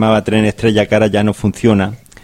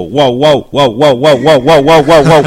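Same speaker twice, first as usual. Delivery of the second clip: loud, high dog barking, louder as it goes; 4 semitones higher, 8 dB louder, slower than usual. Retold or imitated. The dog in the sack barks at the conductor, loud and high. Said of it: "hace el saco".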